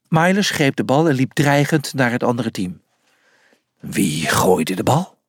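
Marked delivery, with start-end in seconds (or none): 3.8-5.2 low, slow menacing roar. A narrator's reading. The cat faces the other team, low and slow menace. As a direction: make the question a threat. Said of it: "brulde hij".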